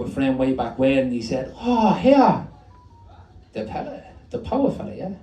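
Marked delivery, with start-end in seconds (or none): 1.6-5.1 high-pitched matey hail; high, surprised street greeting; old friend's greeting. Acted.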